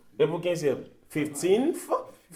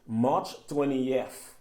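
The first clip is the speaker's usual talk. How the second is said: slow, careful "My birthday is March twentieth"; slow teacher demo of a full English sentence; slow model sentence; much slower than usual. Shown as a demonstration. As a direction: spell each word out slowly for the class. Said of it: "m ap di".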